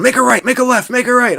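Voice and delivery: high-pitched mock yell of directions; imitated yelling. Man yelling in driver's ear, high mock yell. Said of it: "yelling in my ear".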